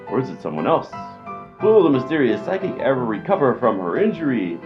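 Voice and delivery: normal talking voice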